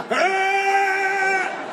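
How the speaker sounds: strained rising yell; power-up yell. Retold or imitated. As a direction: belt it like a cartoon power-up. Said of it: "full episode power-ups where they're like".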